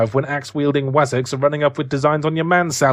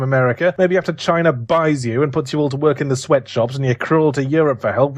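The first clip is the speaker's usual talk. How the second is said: usual speaking voice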